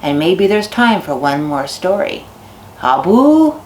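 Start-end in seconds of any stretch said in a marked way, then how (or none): 2.8-3.5 "Habu?" called with a bright rising lift. Shown as a demonstration. Storyteller calls "Habu?" to listeners, rising call. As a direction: ask "Habu?" up high to the audience. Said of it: "every time I say habu".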